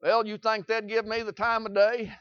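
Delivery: ordinary talk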